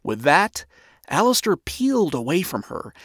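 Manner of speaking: plain reading voice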